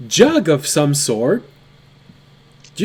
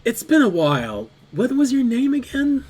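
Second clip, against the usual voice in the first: cheery half-sister; 7 semitones higher than usual. Acted. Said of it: "She turns to you and says".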